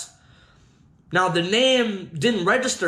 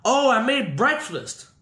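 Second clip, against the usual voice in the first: imitating a stranger, lightly pitched up; a hint of someone else's higher voice; voice from downstairs; 7 semitones higher than usual. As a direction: call it up like the unseen host. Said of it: "I hear a voice saying".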